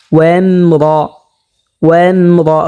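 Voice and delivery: recited ওয়ামরা with a thick, rounded রা; heavy R. Shown as a demonstration. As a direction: make the রা of ওয়ামরা full and heavy. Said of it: "রাকে মোটা করতে হবে".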